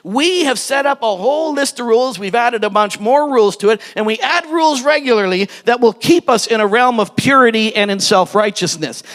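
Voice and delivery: ordinary talk, nothing marked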